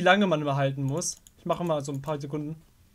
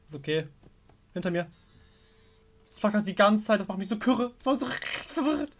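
normal talking voice

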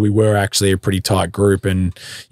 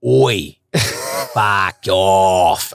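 mock Aussie accent; much slower than usual. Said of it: "he always just comes out of nowhere and goes".

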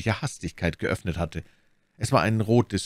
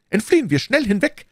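very high, loud alarm; urgent cry; 5 dB louder than usual. A narrator's reading. Milady urges Bonacieux to flee, urgent cry. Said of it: "schrie My Lady".

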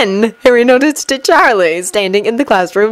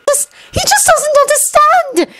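distraught cry. Acted. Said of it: "she cried".

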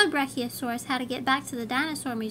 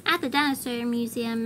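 plain reading voice

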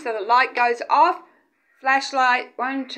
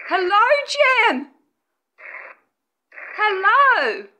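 higher, louder, slow drawn-out greeting; calling out; 5 semitones higher, 3 dB louder, slower than usual. Real, not acted. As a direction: call out across the dark room. Calling out to a spirit.

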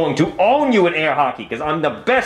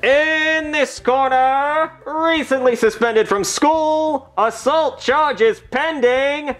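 slow, drawn-out fight announcer call, pitched higher; boxing ring announcer; 8 semitones higher, much slower than usual. Acted. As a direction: stretch every word like a ring announcer.

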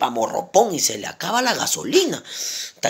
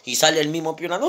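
usual speaking voice

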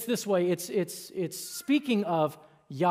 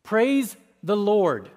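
slow, louder reading of Praise the Lord; scripture reading; 5 dB louder, slower than usual. Shown as a demonstration. Preacher reads the psalm aloud to the congregation, slow and firm. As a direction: read Praise the Lord out slowly, firmly. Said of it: "This is what it says".

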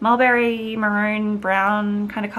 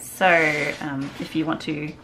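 normal talking voice

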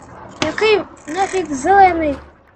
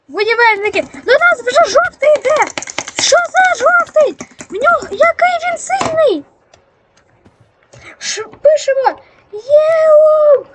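high, urgent yelling at teammates; rally the team, shouting orders; battle cry shouting; 7 semitones higher than usual. Real, not acted. Gamer yelling orders at teammates during attack, high and urgent. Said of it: "в мене вже рот болить кричати".

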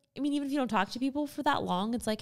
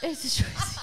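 high, laughing repeat; laughing echo; 8 semitones higher, slower than usual. Real, not acted. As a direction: tease her back with a laugh.

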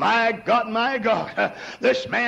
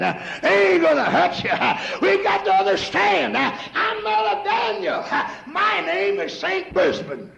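higher, shouted preaching burst; full-force preaching; 7 semitones higher than usual. Acted. Preacher declaring himself to the congregation, shouted preaching. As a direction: break out into a shout.

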